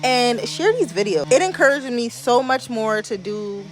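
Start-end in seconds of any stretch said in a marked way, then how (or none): none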